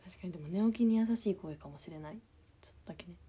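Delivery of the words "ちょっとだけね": whisper. Real, not acted. Whispered aside.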